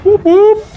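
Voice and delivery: high robotic whoops; playful high-pitched bot whoop; imitated bot noises. Imitating the delivery bot's noises.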